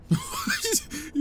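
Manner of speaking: a sudden high giggle that squeaks up; high squeaky laugh. Real, not acted. Laughing at fleeing enemy.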